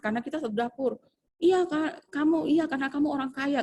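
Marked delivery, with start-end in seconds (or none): 1.4-3.6 higher, sarcastic senior teacher snapping; mock scolding. Senior teacher scolds new teacher for wasting food, mock angry and higher. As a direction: jab at the rich new girl. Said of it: "dia bilang gitu".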